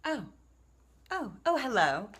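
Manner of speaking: mock-startled little hello; feigned surprise. Author greeting viewers in her book video, feigned surprise. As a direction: act as if just noticing the camera.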